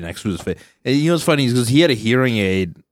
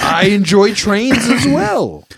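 high, slow mock nerdy voice; prim, earnest train enthusiast; mocking a quirky nerd, high and slow; 10 semitones higher, 4 dB louder, slower than usual.

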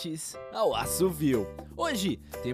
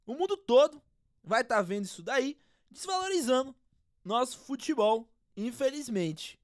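footballer interview voice; 7 dB louder than usual. Acted. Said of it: "O craque palmeirense".

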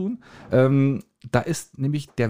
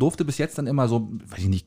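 normal talking voice

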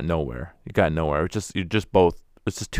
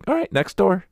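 high, louder, brusque mock adult; brisk adult; 13 semitones higher, 5 dB louder than usual. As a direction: wave the kid on, impatient. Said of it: "the adults are just like".

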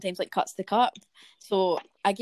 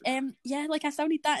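faux-polite insistence; 7 semitones higher than usual. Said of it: "So I was like".

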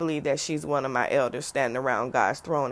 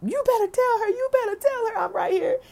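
put-on high, loud, insistent old lady voice; feisty grandma; 3 dB louder than usual. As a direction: voice a pushy grandmother from beyond, pitched way up. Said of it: "She is sitting here going crazy".

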